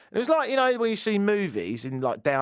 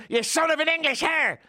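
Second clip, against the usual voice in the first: high Irish yell, mangled word; Irish accent; 12 semitones higher than usual. Retold or imitated. Homeless man insulting him again, in a high Irish accent. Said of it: "So he goes".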